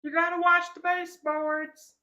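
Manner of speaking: high-pitched mock Southern wife; weird Southern accent. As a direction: imitate your wife nagging in a silly high Southern twang. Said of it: "giving you a weird Southern accent".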